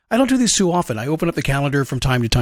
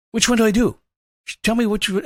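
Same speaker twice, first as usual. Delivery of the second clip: higher-pitched, lightly acted dog pleading for direction; puzzled dog; 7 semitones higher than usual. Dog asking handler for directions, mildly urgent puzzlement. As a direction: gently play a confused dog. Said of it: "in a dog's world, he's like".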